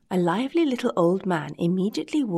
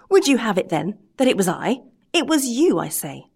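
louder, rising indignation; heated retort; 3 semitones higher, 5 dB louder than usual. Acted. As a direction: throw the blame back at him. Carpenter denies, Geppetto retorts.

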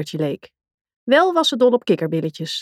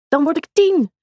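put-on high, eager little girl's cry; happy exclaim; 8 semitones higher than usual. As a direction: call it out with delight as a child. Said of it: "riep Begonia blij".

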